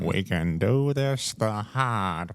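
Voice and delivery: put-on slow, drawn-out old-time announcer; hammed-up old-time radio drawl. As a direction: haul out every word like a cartoon Joker. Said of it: "You were hauling it out like that".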